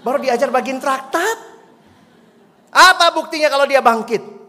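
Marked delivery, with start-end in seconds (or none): 2.7-4.4 loud, higher scornful shout; angry demand. Retold or imitated. Old man shouts his demand for proof at the girl. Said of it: "belum belajar apologetik ya".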